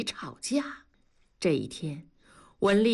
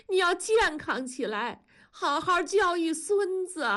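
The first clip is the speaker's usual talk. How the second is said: loud; 7 semitones higher than usual. A narrator's reading. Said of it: "大声地说".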